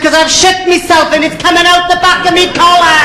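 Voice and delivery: high, hammed-up distress; panicked cry. Novice flyer confessing to the controller, panicked. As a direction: wail it in comic panic.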